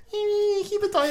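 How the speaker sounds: very high squeaky mock kid voice; thirteen-year-old boy. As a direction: squeak it like a boy nervously standing up to older lads. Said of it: "I'm thirteen years old".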